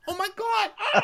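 extremely high shrieked laughing delight; hammed-up squeal of amusement; Ramona. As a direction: shriek it in caricature. Acting Ramona's shrieking reaction.

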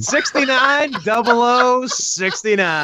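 slow, relishing every number; slow savoring. Pretend. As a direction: drag out the numbers with glee.

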